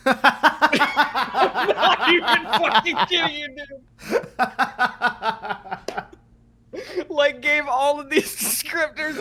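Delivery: words pushed out through real laughter; can barely get it out for laughing; laughing hard. Real, not acted. Telling a friend the urgent care story, breaking up laughing.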